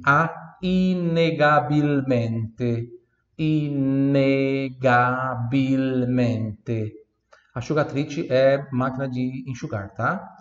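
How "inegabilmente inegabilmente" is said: inegabilmente drawn out very slowly; very slow model word. Shown as a demonstration. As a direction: spell out inegabilmente syllable by syllable. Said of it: "a última palavra com G". (inegabilmente bem devagar)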